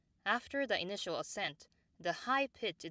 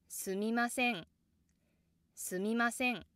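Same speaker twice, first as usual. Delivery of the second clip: すみません rises after す, stays high, drops after せ; すみません with low す, high みませ, falling ん; low start, immediately high; 4 semitones higher than usual. Shown as a demonstration. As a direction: climb at once in すみません and hold until the downstep on ん. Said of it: "start with the low pitch and go immediately high".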